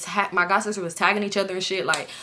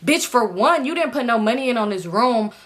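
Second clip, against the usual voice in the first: usual speaking voice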